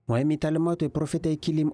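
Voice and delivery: ordinary talk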